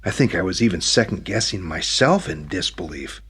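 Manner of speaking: ordinary delivery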